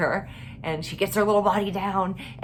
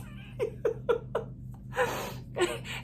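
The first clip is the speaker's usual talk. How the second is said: airy laugh; 8 dB quieter than usual. Real, not acted. Laughing at the pee story.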